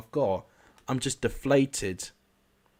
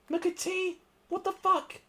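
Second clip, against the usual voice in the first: much higher, disbelieving; shocked outburst. Real, not acted. Gamer shocked by his player's mistake, very high. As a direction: yelp in disbelief at the blunder.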